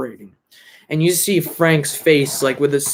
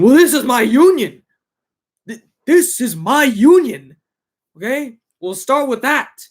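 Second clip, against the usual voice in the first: higher, louder, insistent pushback; heated retort; 9 semitones higher, 6 dB louder than usual. Retold or imitated. Hoffa refusing Russell about his union, loud heated insistence. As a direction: push back hard, claiming what's yours.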